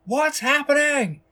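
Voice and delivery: high, loud, slow panicked yell; panicked shout. A narrator's reading. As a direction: shout it blinded and confused. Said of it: "shouts the man in charge".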